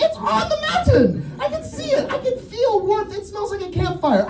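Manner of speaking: very high-pitched hammed-up panic; freaking out. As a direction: insist in a squealing mock panic. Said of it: "You gotta stop freaking out".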